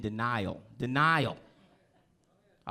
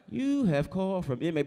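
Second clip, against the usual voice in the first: raised, loud automated operator announcement; automated prison call voice; 7 semitones higher, 5 dB louder than usual. Acted. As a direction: announce it like a recorded jail call. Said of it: "they call you on the phone. It's like".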